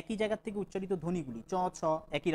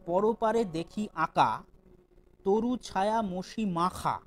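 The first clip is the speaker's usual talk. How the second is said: slow, sonorous verse reading; slow poetic recitation of the example; poem recitation; 4 dB louder, much slower than usual. Shown as a demonstration. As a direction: draw each word out like reading poetry aloud.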